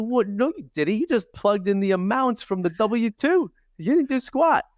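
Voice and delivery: high-pitched, incredulous mock outrage; indignant retort. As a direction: call out their claim in disbelief. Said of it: "watch your tone".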